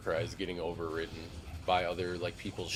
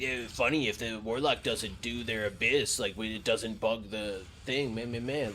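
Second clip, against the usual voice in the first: ranting player; 3 semitones higher than usual. Retold or imitated. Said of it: "he's like".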